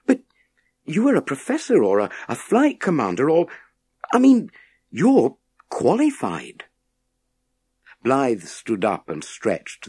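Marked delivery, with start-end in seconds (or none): 0.1-6.6 put-on lightly raised young voice for Scott; a boy answering, unsure and hesitant; higher boy's voice. A narrator's reading. Boy answers the professor in a raised young voice.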